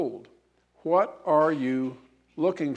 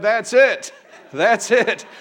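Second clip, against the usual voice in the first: higher, louder, laughing with joy; excited shout; 7 semitones higher, 6 dB louder than usual. Acted. Discharged soldier shouts with joy at his discharge papers, laughing. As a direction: burst out with delighted laughter. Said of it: "jumped up excitedly and shouted".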